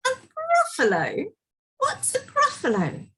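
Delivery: high-pitched questioning snake voice; puzzled snake. Acted. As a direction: ask in high, baffled disbelief. Snake puzzled by unknown creature.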